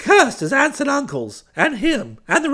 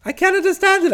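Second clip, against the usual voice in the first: higher-pitched, baffled exclamation; bewildered protest; 6 semitones higher, 3 dB louder than usual. Acted. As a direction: puzzle aloud, voice lifted. Uncle gone, Bertie bewildered before Florence.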